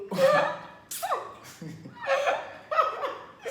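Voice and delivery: long high shriek of laughter. Real, not acted. Boyfriend cracking up while retelling, high shrieking laugh.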